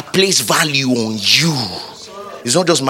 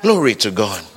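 ordinary delivery

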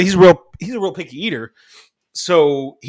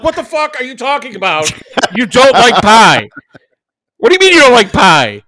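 high-pitched outrage, building louder; mock meltdown; 9 semitones higher, 5 dB louder than usual. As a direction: imitate a kid appalled beyond belief. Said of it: "having a straight up meltdown".

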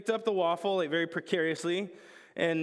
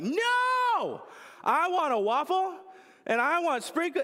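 put-on much higher, loud shrieking little-girl demand; toddler tantrum; 12 semitones higher, 3 dB louder, slower than usual. Toddler insisting to dad she wants sprinkles, high shriek. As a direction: shriek it like a toddler losing patience.

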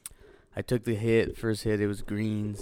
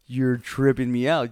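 loud and higher, arguing; heated retort; 4 semitones higher, 11 dB louder than usual. Retold or imitated. Paranoid partygoer arguing with the band's drummer, heated. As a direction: reenact pleading over the noise.

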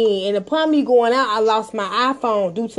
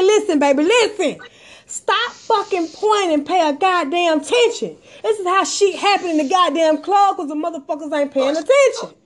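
higher-pitched, heated retelling of a telling-off; retold exasperated scolding; 6 semitones higher than usual. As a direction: re-enact losing patience with someone not listening. Retells scolding the oblivious friend.

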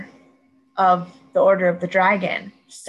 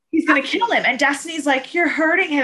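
louder, higher, urgent storytelling; excited retelling; 7 semitones higher, 6 dB louder than usual. Real, not acted. Fan reliving Destiny's plea, high and urgent. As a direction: push it up with urgency. Retelling tense rescue scene.

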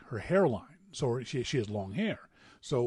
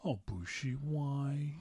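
sad mom; 10 dB quieter, much slower than usual. Retold or imitated. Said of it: "with these big, sad mom blue eyes went".

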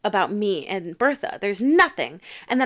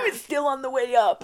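straining to climb; 3 semitones higher than usual. Retold or imitated. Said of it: "everyone is up here and Sheridan is like".

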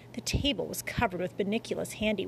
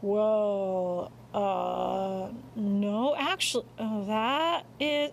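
hesitant; much slower than usual. A narrator's reading. Father hesitates over an answer.

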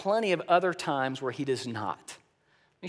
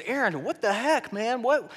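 ticked off; 4 semitones higher than usual. Retold or imitated. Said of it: "he is ticked".

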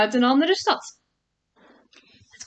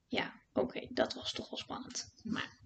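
whispered aside; 3 semitones lower, 13 dB quieter than usual. A narrator's reading. Vertelster geeft iets toe.

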